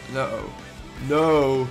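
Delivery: usual speaking voice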